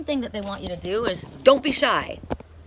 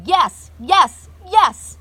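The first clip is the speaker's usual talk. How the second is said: slow, then loud high shouted yeses; joyful shout of yes; 9 semitones higher, 13 dB louder, much slower than usual. Shown as a demonstration. Reader showing how to say yes to the universe, shouting. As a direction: shout your yes up to the sky. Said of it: "Then say to the universe".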